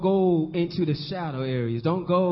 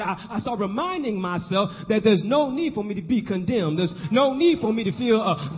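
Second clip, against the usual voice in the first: insistent repeated phrases swelling to a loud cry; building preaching; 4 semitones higher, 4 dB louder than usual. Real, not acted.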